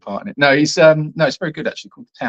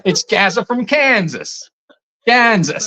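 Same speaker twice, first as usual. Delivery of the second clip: high, slow hammed-up Kansas twang; mock Kansas accent; 8 semitones higher, slower than usual. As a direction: stretch it in a put-on Kansas accent. Playfully voicing viewer from Kansas.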